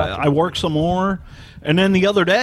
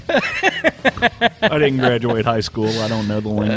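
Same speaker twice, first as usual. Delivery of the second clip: slow, lower dopey voice; slow mock-dumb; 6 semitones lower, slower than usual. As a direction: play dumb, slowly. Self-mocking aside.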